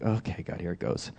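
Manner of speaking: low, very soft resigned aside; reluctant bracing. Retold or imitated. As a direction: mutter a reluctant little prayer. Bracing himself before dreaded call.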